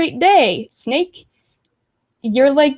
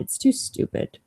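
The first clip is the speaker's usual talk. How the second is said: whispered aside; 7 dB quieter than usual. Real, not acted. Muttered aside, dismissing the DM.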